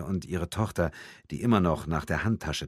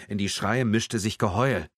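usual speaking voice

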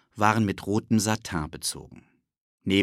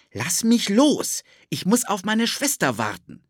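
put-on boy protesting; 12 semitones higher, 4 dB louder than usual. Philipp resists Ted, high protesting boy voice. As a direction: push back against the grabbing boy.

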